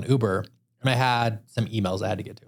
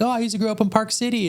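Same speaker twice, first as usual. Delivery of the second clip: much higher, delighted chatter; excited driver; 12 semitones higher than usual. Retold or imitated. Driver thrilled to share his hometown, high and excited. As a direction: gush like the driver finding common ground. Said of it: "He's like".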